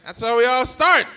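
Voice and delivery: normal talking voice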